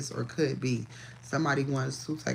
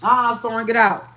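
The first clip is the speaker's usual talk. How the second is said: loud high order to the dog; shooing command; 7 semitones higher, 10 dB louder than usual. Real, not acted. Ordering Storm the dog out of the room, loud. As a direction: shoo the dog out firmly.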